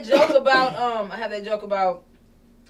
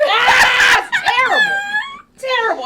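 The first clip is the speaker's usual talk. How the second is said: shrieking, much higher and louder laugh-cry; cracking up; 10 dB louder, slower than usual. Real, not acted. Comedian laughing at her own awful British accent, shrieking. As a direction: dissolve into laughter at your own terrible accent.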